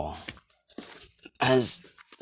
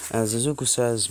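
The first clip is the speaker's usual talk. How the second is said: usual speaking voice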